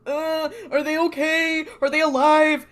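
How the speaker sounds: hammed-up frantic pleading, voice climbing; frantic worry. Sam frets to the paramedics.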